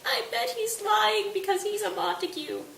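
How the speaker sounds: very high put-on lady's voice; mock Lady Capulet. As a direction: accuse in a sustained high pitch. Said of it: "And Lady Capulet, who showed up to cry".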